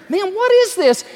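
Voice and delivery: amazed. Retold or imitated. The man with the gin gapes at the massive crowds, high and loud. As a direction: gawk at the huge crowd. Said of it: "he's going".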